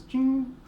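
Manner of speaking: high, ringing "jing"; high-pitched little chime; imitated bell jingle. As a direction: mimic a shop doorbell. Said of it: "the bell jingles".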